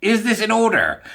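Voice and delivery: high, loud indignant judge; hammed-up courtroom judge. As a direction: demand it like a judge in a joke. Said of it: "And the judge says".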